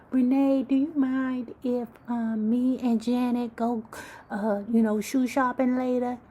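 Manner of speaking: high, loud mock-sweet girlfriend voice; sugary high friend voice; friend asking sweetly. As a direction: put on a high chirpy girlfriend asking permission. Janet's friend voiced asking Renee.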